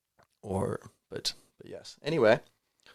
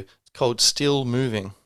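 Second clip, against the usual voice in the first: ordinary delivery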